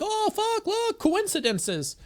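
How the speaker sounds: squealing high excitement, slowed; mock-giddy discovery; excited. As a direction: gasp at the coincidence. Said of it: "did you see when I got excited".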